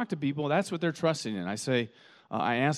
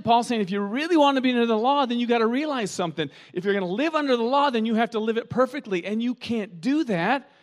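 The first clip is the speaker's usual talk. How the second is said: much higher, louder, fast; fired-up preaching; 7 semitones higher, 4 dB louder than usual. Real, not acted. Pastor warning his church about the law, fired-up and loud. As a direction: drive the point home hard. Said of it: "preaching at full force".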